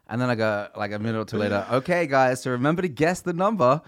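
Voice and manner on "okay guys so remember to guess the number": calling out. Retold or imitated. Reminding family to guess number.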